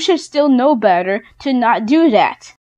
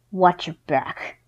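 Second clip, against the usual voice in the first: quiet threat; 6 semitones lower, 7 dB quieter than usual. Real, not acted. Warning to the bully.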